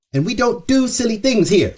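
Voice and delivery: high, prim, tongue-in-cheek; mock prim host. Real, not acted. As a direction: say it like a proper host, teasing.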